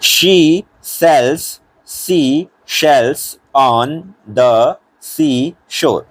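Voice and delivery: each word stretched out, very slow; teacher modelling the twister word by word; slow tongue twister. Shown as a demonstration. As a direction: spell out the s and sh sounds one by one. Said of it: "जीभ को मोड़ना पड़ेगा".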